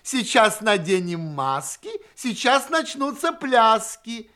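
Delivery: put-on high, loud, merry call to children; cheerful shout. A narrator's reading. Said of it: "кричит весёлым голосом".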